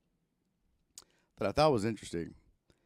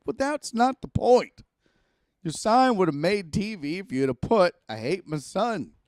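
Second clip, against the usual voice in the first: angry at mom; 7 semitones higher, 9 dB louder than usual. Retold or imitated. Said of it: "I was so pissed".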